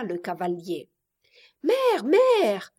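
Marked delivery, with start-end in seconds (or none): 1.6-2.7 put-on high, loud little girl's call; child crying out. A narrator's reading. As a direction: call urgently for mother in a child's voice. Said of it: "cria tout à coup l'enfant". (cri aigu de fillette)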